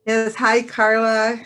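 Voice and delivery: normal talking voice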